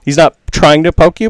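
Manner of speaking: usual speaking voice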